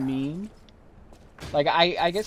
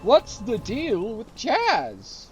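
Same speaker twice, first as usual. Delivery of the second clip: slow, higher, louder bad Seinfeld observational whine; Jerry Seinfeld impression; 8 semitones higher, 4 dB louder, slower than usual. Mocking famous people doing Seinfeld, a bad Seinfeld voice. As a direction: hammer each question like a bad Seinfeld bit. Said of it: "That's not a very good impression of Jerry Seinfeld".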